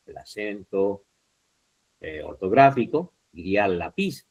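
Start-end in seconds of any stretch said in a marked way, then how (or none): 3.7-4.3 "lápiz" stressed on PIZ, rising; misstressed la-PIZ; wrong final stress. Shown as a demonstration. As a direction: push "lápiz" onto its end. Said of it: "Si usted no le pone la tilde".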